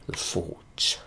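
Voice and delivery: whispered under the breath; softly whispered aside. Shown as a demonstration. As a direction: breathe the name out, no voice. Repeats the name, whispered.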